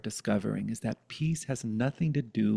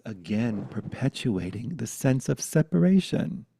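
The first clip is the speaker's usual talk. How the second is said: normal talking voice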